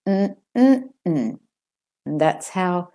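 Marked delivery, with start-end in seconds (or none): 0.1-1.4 "Mm-mm-mm" hummed with the middle one lifted; stressed second syllable. Shown as a demonstration. Teacher hums the word's pattern, "Mm-mm-mm" stressed in the middle. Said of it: "second syllable is the stressed one".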